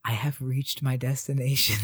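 low, soft, careful delivery; slow, careful whisper. Acted. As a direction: say it slowly and hushed, close to an ear. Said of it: "whisper slowly and carefully".

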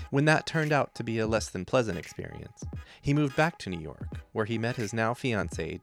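smooth scripted podcast intro; podcast host read. A narrator's reading.

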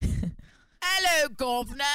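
high, squawked cockney caricature; mock British accent. Mocking British listeners, high caricature British accent. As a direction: squeak a hammed-up British greeting. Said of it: "what I think that British people are".

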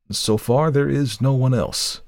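slow, reluctant admission. A narrator's reading. Lawyer admits there is no other witness, reluctantly. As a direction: admit it unwillingly, stretching the words. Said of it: "he said reluctantly".

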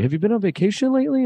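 high, quick talk-show host voice; chirpy late night host question; David Letterman. Retold or imitated. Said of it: "you're David Letterman".

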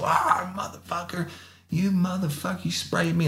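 high, strained cursing in pain; pepper-sprayed suspect. Retold or imitated. Suspect cursing the cop who sprayed him, high and pained. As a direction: yelp the curses like the spray just hit you.

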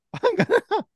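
high, slow mock stab noise; cartoon stabbing effect, laughing; stabbing sound.

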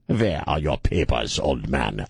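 put-on slow, low movie-Nazi German accent. Showing how movie Germans talk, a slow low Nazi voice. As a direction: growl it like a film Nazi officer. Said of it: "talk like this".

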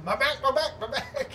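high, frantic cries of pain; imitated screaming. Frank going down the slide, imitated pained screaming. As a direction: cry out in mock agony. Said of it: "as he's going down screaming".

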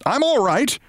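high-pitched yelp of reassurance; reassuring yell. A narrator's reading. Briggs reassuring mother, high yell. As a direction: yell it high, without real volume. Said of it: "Briggs yelled to reassure her".

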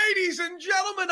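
high shouted ringmaster call; excited shout. Real, not acted. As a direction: belt it like a ringmaster. Opener repeated in a shout.

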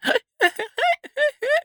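high squeaky bedspring creak; squeaky llama noise. Pretend. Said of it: "I'll pretend they sound like a squeaky bed".

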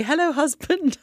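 playful River greeting. Pretend. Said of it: "I just have to say".

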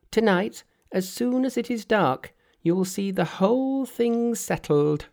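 high, perky monkey king voice; cheeky monkey hero. Acted. Monkey's voice in read story.

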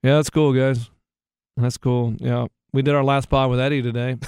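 glum mumble. Retold or imitated. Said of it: "And I'm just like".

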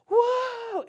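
huge, high, loud whoa; big celebration. Shown as a demonstration. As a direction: throw the biggest party for a toddler. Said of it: "the party's gotta be big".

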